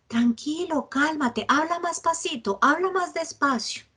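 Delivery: a helper telling an upset person to calm down; calming someone down. Retold or imitated. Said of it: "le decimos".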